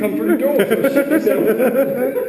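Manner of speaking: loud, slow, laughing through words. Real, not acted. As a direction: laugh while asking. Laughing disbelief.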